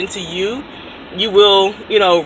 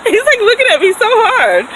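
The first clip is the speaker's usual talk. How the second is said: high, loud, giggly report; tickled by the attention, nearly laughing; giggly amused; 13 semitones higher, 5 dB louder than usual. Real, not acted. Amused at a staring man.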